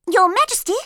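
very high, loud urgent call; hurried interruption. A narrator's reading. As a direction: burst in breathless. Jumping up in a great hurry.

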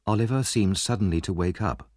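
ordinary delivery

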